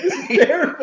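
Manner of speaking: high, loud, laughing mock dismay; laughing disgust. Guest in a filthy house, laughing in mock horror. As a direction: squeal it through laughter, grossed out.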